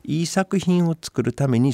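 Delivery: ordinary talk, nothing marked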